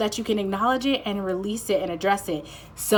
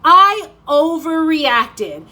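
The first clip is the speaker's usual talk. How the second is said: higher, louder, very slow drawn-out relief; a hint of a relieved little girl; little girl's relief, higher; 7 semitones higher, 10 dB louder, much slower than usual. Girl after ear piercing, slow relieved repeat. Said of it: "and she's like".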